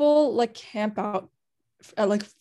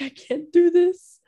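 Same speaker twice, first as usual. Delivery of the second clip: louder and higher, slowly breaking; overwhelmed cry; 9 semitones higher, 7 dB louder, slower than usual. Retold or imitated. Retelling her breakdown at the [name] concert, overwhelmed. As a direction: let it spill out like you're about to cry.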